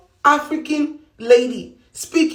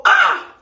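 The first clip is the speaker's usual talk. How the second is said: sudden yelp, much higher; 4 dB louder than usual. Real, not acted. Woman telling her work story breaks off with a yelp. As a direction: let out a quick startled yelp.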